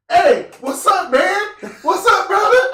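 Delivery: high, loud imitation of an eager stranger; mock overfriendly greeting. Random guy greets a stranger in the store, mock overfriendly.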